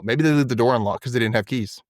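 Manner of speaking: usual speaking voice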